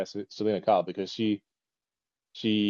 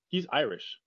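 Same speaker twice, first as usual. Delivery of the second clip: ordinary talk, nothing marked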